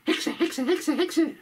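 high yapping dog voice. Acted. Dog Fido crying out about the witch. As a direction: yap it like an excited little dog. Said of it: "wat schreist du denn so".

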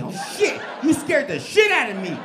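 mock fright; startled shout. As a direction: jump out of your skin. Dad startled, acted.

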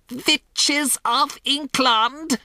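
high, slow shout with v for w; drawn-out, accented witch's rallying cry; commanding witch shout. A narrator's reading. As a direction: summon the assembly as a tyrant witch. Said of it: "she yelled".

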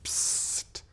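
Psst as a soft unvoiced hiss and t; Psst demo. Shown as a demonstration. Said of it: "the only word ... no vowels".

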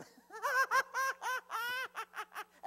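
loud, high, rapid-fire cackling laugh; mock hysterical laughter. Preacher mimicking people laughing off their chairs, mock hysterical laughter. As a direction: burst into a long shrieking imitation laugh.

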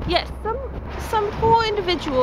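affected British lilt; put-on British accent. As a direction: lament it in a prim fake British voice. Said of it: "I'm going to vlog in a British".

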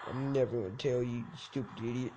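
mock villain taunt; lightly voiced villain. Voicing NPC taunt.

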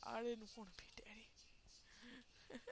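soft slow whisper; whispered joke. Real, not acted. Gamer joking about the kid he is carrying, whispered. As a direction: whisper it like a guilty confession.

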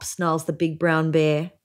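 ordinary delivery, nothing marked

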